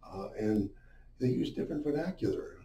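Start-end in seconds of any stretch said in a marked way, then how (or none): none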